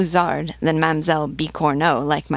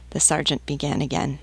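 usual speaking voice

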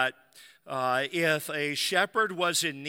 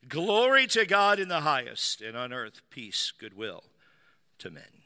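praising. Acted. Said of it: "praising God and saying".

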